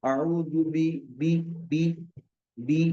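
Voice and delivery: बी with a clear long ee; बी said as bee, not बे; correct long ee. Shown as a demonstration. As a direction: stretch the vowel in बी to a long ee. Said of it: "बी को बी पढ़ेंगे".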